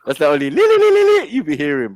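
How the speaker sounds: siren imitation. Teasing a friend, siren sound with a laugh. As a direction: slip back into the siren wail, laughing. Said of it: "that is called sirens".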